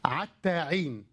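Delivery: عتاعين drawn out slowly with ʕain for ħ; echoing the sheikh's word. Retold or imitated. Host mockingly repeats the sheikh's عتاعين, slow and drawn out. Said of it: "حتى حين عتاعين".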